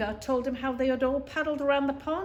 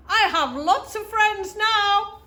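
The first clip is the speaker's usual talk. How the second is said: higher, louder, slow distant echo; echo from across the pond; 10 semitones higher, 10 dB louder, slower than usual. A narrator's reading. The echo answering Little Beaver from across the pond, slow and loud. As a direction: call it back like a far echo. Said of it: "a voice answered him".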